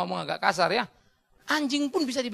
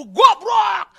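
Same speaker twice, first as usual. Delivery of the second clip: put-on furious shout at the child, much higher and louder; angry shout; 12 semitones higher, 14 dB louder than usual. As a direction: snap it out like a father losing his temper. Ayah memarahi anak karena emosi. (bentakan marah seorang ayah kepada anak)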